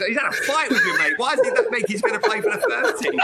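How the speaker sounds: can't believe it, laughing; laughing disbelief. Real, not acted. Asking the host why a brawler gets picked, laughing.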